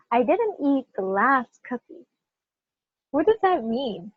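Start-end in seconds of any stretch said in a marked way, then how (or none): 0.1-2.0 "last" held longer than the other words; stress on LAST. Shown as a demonstration. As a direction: pick out "last" with emphasis. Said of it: "last, right?".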